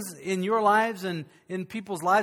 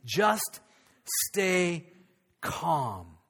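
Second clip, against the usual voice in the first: calming; 4 semitones lower, 4 dB quieter, much slower than usual. Acted. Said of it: "Moses speaks up to the people and says".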